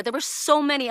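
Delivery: ordinary talk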